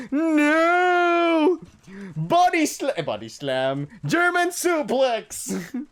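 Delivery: high, loud, slow squealing calls; playful falling squeals; mock wrestling shriek. Being tackled backwards.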